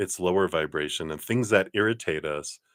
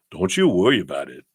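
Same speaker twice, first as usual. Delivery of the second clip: lightly hammed-up New Jersey gangsta accent; attempted Jersey accent; 4 dB louder, faster than usual. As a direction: have a playful go at a Jersey wiseguy. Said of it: "work on my Jersey accent".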